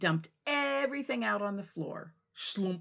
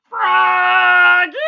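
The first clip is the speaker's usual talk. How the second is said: calling out; 9 semitones higher, 21 dB louder than usual. Acted. Mother calling Froggy back a second time, very loud. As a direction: call him back again. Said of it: "called his mother".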